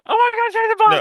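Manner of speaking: very high, loud mock-terrified shriek; piercingly high hammed-up alarm yell; retold scream. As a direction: scream it in pretend horror to wake a sleeper. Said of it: "and screams".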